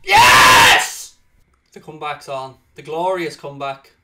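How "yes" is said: loud high cry of joy; triumphant yell. Real, not acted. Gamer screaming with joy at his comeback goal.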